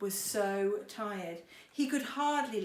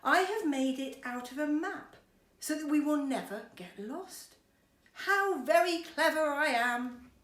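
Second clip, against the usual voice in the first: loud, high, smug brag, slowing at the end; smug and slowing down on the last line; boasting; 5 semitones higher, 7 dB louder than usual. Acted. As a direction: savour every word of self-praise. Mister Clever boasting on.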